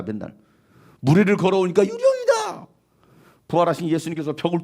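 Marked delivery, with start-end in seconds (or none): 1.8-2.7 high, slow, drawn-out scream of fright; frightened cry. Acted. Disciples seeing risen Jesus, terrified high cry. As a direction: shriek it like you saw a ghost.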